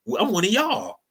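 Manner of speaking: high mock voice claiming belonging; mocking whine. Mocking Irish immigrants joining whiteness in a high sly voice. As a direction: pitch it up, sly and eager. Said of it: "until y'all said".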